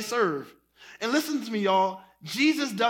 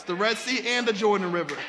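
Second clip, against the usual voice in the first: ordinary talk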